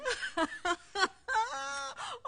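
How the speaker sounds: put-on a high squeal of a laugh over the baby; high delighted laugh. Grandmother laughing with delight as she takes the baby.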